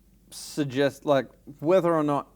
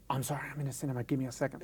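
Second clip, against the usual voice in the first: hushed phone apology; 8 dB quieter, much faster than usual. Acted. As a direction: keep it down as if taking a call mid-film. Acted hushed phone answer in cinema.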